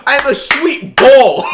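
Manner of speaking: loud, high, slow mock Cockney; over-the-top Cockney impression. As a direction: ham up a fake London accent. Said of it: "Cockney person".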